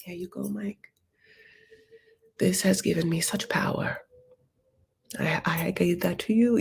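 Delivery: half-whispered hammed-up devotion; hushed mock offering. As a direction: breathe it out like a solemn gift. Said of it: "I wanted Giuliana to be like".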